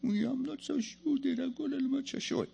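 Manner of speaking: high, soft doubting voice; small high voice, imitating self-doubt; unsure believer. Preacher acting out the congregation's doubt, mock unsure. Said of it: "And we go".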